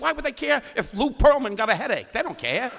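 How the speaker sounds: high, loud mock exasperation; shrill-sounding mock outrage; high exasperated retort. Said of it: "I said".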